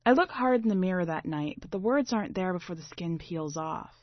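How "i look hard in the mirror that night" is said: ordinary delivery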